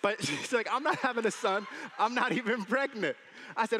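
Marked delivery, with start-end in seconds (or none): 0.7-3.1 louder, higher mock protest; imitated wife. Wife correcting husband about a son, mock protest. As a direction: imitate the wife insisting, loud and raised. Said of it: "that's not how she talks".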